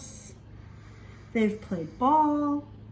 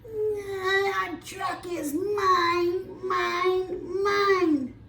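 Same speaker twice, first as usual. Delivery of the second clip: put-on high, drawn-out whiny toddler voice; Grumpy Toad whining to keep his truck; 7 semitones higher, much slower than usual. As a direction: whine it like a toddler hogging a toy. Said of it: "Grumpy Toad starts to whine".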